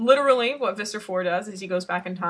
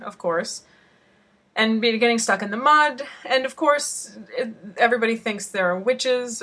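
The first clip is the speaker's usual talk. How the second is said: ordinary talk, nothing marked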